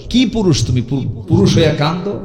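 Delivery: lightly voiced, teasing wife; mocking wife. A hint of a wife mocking her crying husband. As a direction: tease him lightly like a scornful wife. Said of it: "হাসবে আর বলবে".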